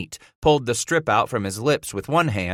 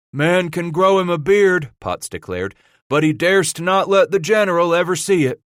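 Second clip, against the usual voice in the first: higher, loud, knowing warning; frontier trapper voice; 10 semitones higher, 6 dB louder than usual. Acted. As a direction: drive the warning home to the free trappers. Potts warns about the general.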